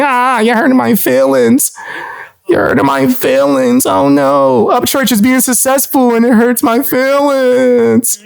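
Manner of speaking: high, whiny mock hurt; mock whiny crybaby. Mocking a rival online, whiny high crybaby voice. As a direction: whine like a hurt baby to mock him.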